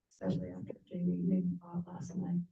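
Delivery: hushed, breathy whisper to spare a sore throat; a real whisper after a coughing fit. Real, not acted. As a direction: keep it to a low whisper.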